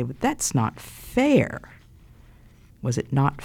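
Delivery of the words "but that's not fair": high, drawn-out protest; complaining child. Retold or imitated. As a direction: imitate a child objecting, stretching it out slowly. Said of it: "some children today would say".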